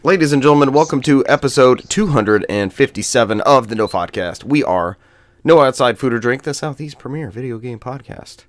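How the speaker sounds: big ladies-and-gentlemen host opening; show announcer intro. Acted. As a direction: announce the show, loud off the top. Podcast host's formal opening.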